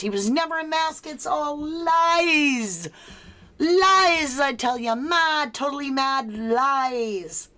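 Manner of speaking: high, loud, drawn-out theatrical cry; hammed-up raving denunciation; mock outrage. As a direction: wail it like a melodramatic conspiracy ranter. Hammed-up outrage reading poll option.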